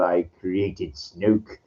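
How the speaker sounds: Palpatine impression. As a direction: drag each word out like the Emperor. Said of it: "I like that thing".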